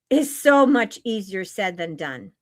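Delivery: ordinary delivery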